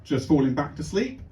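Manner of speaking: ordinary delivery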